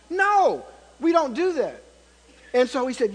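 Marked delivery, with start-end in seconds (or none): 0.1-1.8 loud, emphatic retelling to the principal; raised voice. Retold or imitated. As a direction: push it out hard, insistent. Pastor raising voice at principal.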